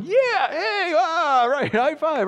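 put-on very high, excited kid voice; cheering kids. Kids at the table loving the blended meal, high cheering. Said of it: "all your kids are at the table going".

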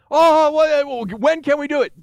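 put-on high, pleading teen voice; eager teens. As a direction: beg like an impatient kid. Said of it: "kids pleading for candy".

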